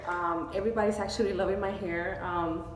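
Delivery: ordinary delivery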